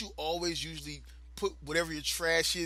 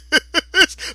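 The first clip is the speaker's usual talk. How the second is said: big laugh; 13 semitones higher, 14 dB louder than usual. Real, not acted. Laughs at thug family.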